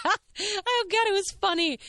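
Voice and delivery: high-pitched, talking through laughter; helpless giggly squeal; real laughing. Real, not acted. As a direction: crack up at the memory. Cracking up at the memory.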